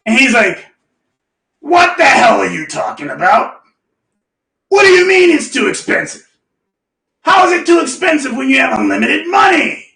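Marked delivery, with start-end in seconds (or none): 1.6-9.8 incredulous mentor, pitched up; mentor impression. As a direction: imitate a mentor scolding in disbelief. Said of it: "This is how he sounds".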